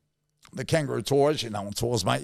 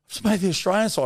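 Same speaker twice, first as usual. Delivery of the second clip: much higher, quick and a bit louder, retelling joy; overjoyed news; 7 semitones higher, 3 dB louder, faster than usual. Retold or imitated. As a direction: blurt out the big news, thrilled. I started crying.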